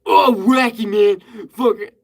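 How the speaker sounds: high, loud goofy hype; wacky YouTuber voice. Pretend. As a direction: play a zany try-hard creator. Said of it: "if I was like that in my videos, like".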